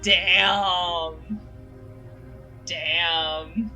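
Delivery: ordinary talk, nothing marked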